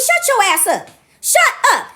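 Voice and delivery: high, emphatic retold telling-off; angry retort. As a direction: snap at him to silence him, pitch jumping up. Retold telling-off of shouting stranger.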